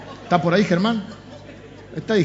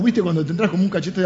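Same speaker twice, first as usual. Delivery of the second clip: ordinary delivery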